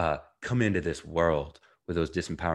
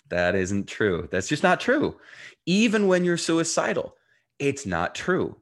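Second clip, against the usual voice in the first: emphatic insistence; 7 semitones higher, 4 dB louder than usual. Real, not acted. Passionate rebuttal of self-sabotage idea.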